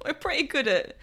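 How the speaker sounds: laughing. Real, not acted. Laughing repeat.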